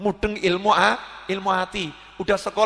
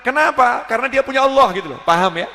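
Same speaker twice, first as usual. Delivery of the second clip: ordinary delivery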